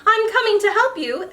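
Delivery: eager call. Acted. James eagerly wades in.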